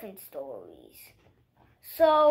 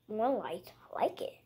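whisper; 4 semitones lower, 8 dB quieter, faster than usual. Acted. Whispered aside.

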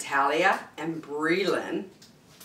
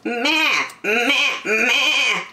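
higher-pitched goat bleats; playful goat noises, pitched up; goat's bleat; 6 semitones higher, 3 dB louder, much slower than usual. Acted. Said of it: "said the goat".

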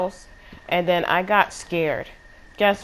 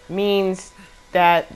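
usual speaking voice

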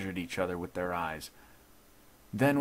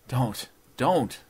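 loud, higher-pitched moan; 5 semitones higher, 6 dB louder than usual. A narrator's reading. Shamed judge to gloating intruder, moaning. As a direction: beg him to stop with a moan. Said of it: "moaned the judge".

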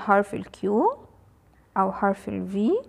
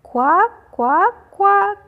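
loud, high, rising quacks; duck quacking; 10 semitones higher, 7 dB louder than usual. Shown as a demonstration. Teacher shows children what a duck says, quacking high and loud. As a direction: quack it out, higher on the last one. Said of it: "ده صوت البطة يا ولاد بتقول ايه؟".

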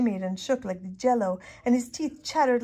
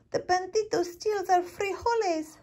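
very high little voice; tiny dog voice; 11 semitones higher, 3 dB louder than usual. A narrator's reading. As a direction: squeak it out.

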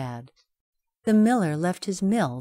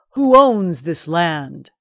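slow, much louder royal call; 11 dB louder, slower than usual. Acted. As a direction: call out across the fields. King calls out loudly.

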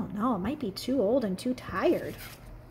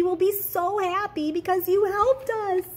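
excited thanks; 10 semitones higher, 5 dB louder than usual. Acted. Toys thanking the engine.

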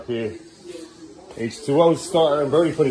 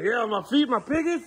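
lightly voiced excited little boy, pitched higher; imitating his son; 12 semitones higher than usual. Dad imitates his son's excited chatter about his feet, lightly. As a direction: play the kid showing off his piggies, higher. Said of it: "He's like".

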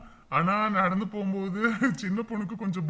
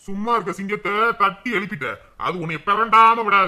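louder mock-menacing boast; father's mock threat, louder; boastful threat; 8 dB louder than usual. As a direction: puff up like a roused lion.

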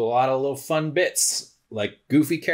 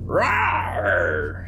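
lion roar; 3 semitones lower than usual. Acted. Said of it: "Rawr, which is lion speak for".

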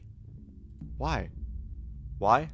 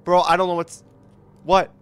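startled; 4 semitones higher, 10 dB louder than usual. Real, not acted. Jumpscare at the window.

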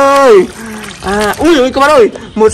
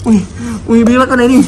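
ordinary talk, nothing marked

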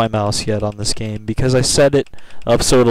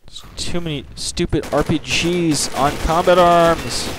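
normal talking voice

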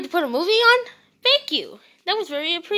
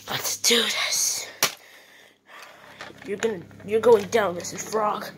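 whisper; 8 semitones lower, 6 dB quieter than usual. Acted. Owner readying his toy gun, whispered. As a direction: breathe it, psyched up. Arming himself against frog.

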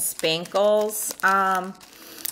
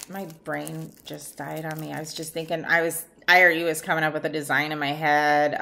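usual speaking voice

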